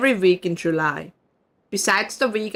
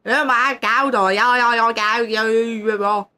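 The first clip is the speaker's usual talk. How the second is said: quick throaty Zurich Swiss German noises; exaggerated Zurich chatter; Zurich dialect. Shown as a demonstration. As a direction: rattle off Zurich filler sounds. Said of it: "the noises they make in Zürich".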